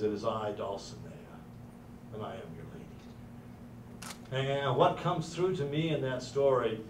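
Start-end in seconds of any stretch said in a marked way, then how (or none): 0.0-4.5 much softer, slow and lower; tender and soft. Retold or imitated. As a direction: lean in gently to a dying man. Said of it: "And she says".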